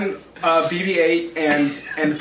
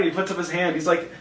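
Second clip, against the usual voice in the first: ordinary talk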